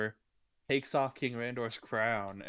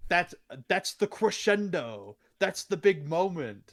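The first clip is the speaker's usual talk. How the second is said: raised annoyed voice; irritated villain; 6 semitones higher, 6 dB louder than usual. Retold or imitated. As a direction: scold like a peeved villain. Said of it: "he's like".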